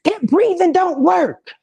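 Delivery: high, whiny mock kid voice; imitating a kid. Therapist mimicking a doubtful kid, high and whiny. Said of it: "kids I've worked with that they be like".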